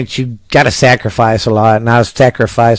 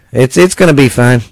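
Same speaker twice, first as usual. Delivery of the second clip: ordinary talk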